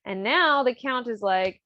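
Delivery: ordinary talk, nothing marked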